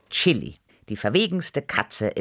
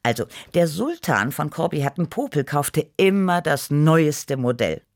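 ordinary delivery

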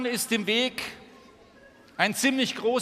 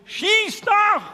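defiant shout; 12 semitones higher, 7 dB louder than usual. Acted. Beetle yells from its back.

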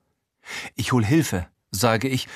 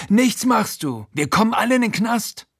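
viel höher und laut, verzweifelt drohend; drohend wütend; 12 semitones higher, 3 dB louder than usual. Acted. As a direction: schreit mit dem Messer in der Hand. Frankie bedroht mit Messer. (Frankie schreit den Erzähler viel höher und laut wütend an)

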